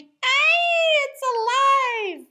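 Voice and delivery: high, loud terrified shriek; frightened scream. A narrator's reading. Man screaming when the doll comes alive. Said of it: "The man screamed".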